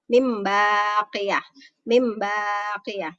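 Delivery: long hummed m in "Mim" before baqiyah; slow recitation, m sustained in "Mim baqiyah"; ba read as mim. Shown as a demonstration. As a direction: hold the m of "Mim", then land on baqiyah. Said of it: "kayak ada huruf mimnya".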